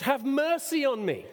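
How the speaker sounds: high-pitched plea, not quite shouted; retold cry for mercy. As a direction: plead as a blind beggar calling out. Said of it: "mercy he cried out for".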